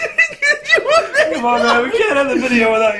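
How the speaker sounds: loud, high burst of laughter; laughing shout. Real, not acted. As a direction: howl with laughter. Big laugh fit.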